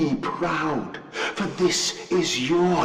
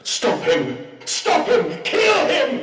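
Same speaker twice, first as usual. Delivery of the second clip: rising, desperate shouts of stop him and kill him; shouted orders. Acted. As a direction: scream the command to seize him. Machine ordering its pigs to attack.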